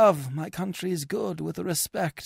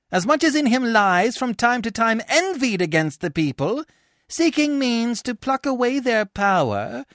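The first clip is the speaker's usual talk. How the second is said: a tribune hurling charges, pitched up; accusing tribune; 9 semitones higher, 5 dB louder than usual. Acted. Tribune condemning Coriolanus to the people, accusing. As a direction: lay out the charge like a prosecutor.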